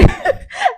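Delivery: squealing laugh; high laugh. Real, not acted. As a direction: break into a high giggle. Laughs at obsession.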